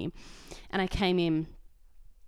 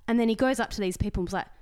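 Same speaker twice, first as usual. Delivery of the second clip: ordinary talk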